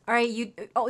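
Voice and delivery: normal talking voice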